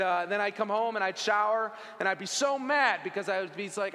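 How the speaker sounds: ordinary delivery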